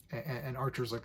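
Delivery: usual speaking voice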